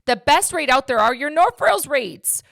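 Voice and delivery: loud, high, passionate rant; yelling. Real, not acted. Mortgage agent yelling to viewers about North Rails rates. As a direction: raise the voice and hammer the point. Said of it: "I'm yelling".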